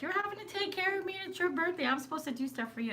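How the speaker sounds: high-pitched retold protest, easing down at the end; retold fretting. Retold fretting at husband on birthday.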